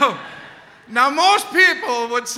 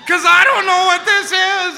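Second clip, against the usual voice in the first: panicked rant; 7 semitones higher, 5 dB louder than usual. Retold or imitated.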